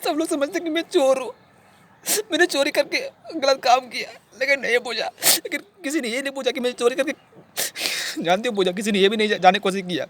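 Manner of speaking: high-pitched, crying voice; tearful protest. Acted. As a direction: plead through tears to be understood. Tearful plea at being called thief.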